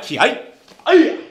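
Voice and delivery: slow, high, loud karate yell; drawn-out, powerful kiai; kiai shout. Real, not acted. Instructor ending inside strike drill, kiai shout.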